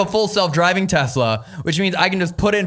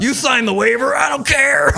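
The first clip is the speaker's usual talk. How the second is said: mock shout; 10 semitones higher, 4 dB louder than usual. Mocking guest's rough handling.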